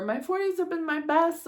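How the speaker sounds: mock gushing women. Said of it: "they're like".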